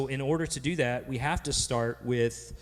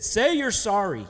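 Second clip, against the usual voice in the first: mad mom; 6 semitones higher than usual. Retold or imitated. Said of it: "my mom was so mad at me".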